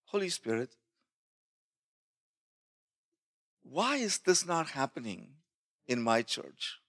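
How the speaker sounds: softly retold, searching question; quiet prayer. Pastor asking the Holy Spirit about his church, quietly pleading. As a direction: ask it softly, with long pauses.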